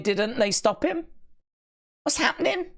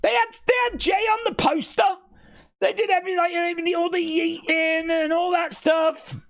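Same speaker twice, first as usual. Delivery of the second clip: high, fast outburst; excited rant; 9 semitones higher than usual. Real, not acted. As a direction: rush it out, pitched up high. Ranting about the booking.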